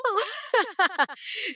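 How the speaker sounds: loose, high burst of laughter; high-pitched cackle. Acted. Candidate mocking the crowd, high cackle. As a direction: cackle high before the insults. Laughs before insulting the listeners.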